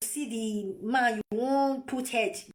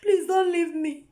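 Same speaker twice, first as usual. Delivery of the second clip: mock crying plea; 3 semitones higher than usual. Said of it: "you be crying".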